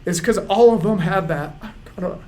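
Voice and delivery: high, tearful voice with long breaks; choked up. Real, not acted. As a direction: fight real tears to get each phrase out. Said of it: "I'm getting choked up".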